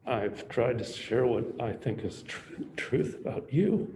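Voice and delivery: halting, tearful tribute with long pauses; holding back tears. Real, not acted. Speaker honours an admirable young man, holding back tears. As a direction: speak haltingly, fighting not to cry. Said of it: "So much for not crying".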